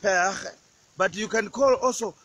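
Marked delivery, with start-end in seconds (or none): 0.0-0.5 père with a throaty French r. Shown as a demonstration. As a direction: say père as pehr. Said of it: "that is French".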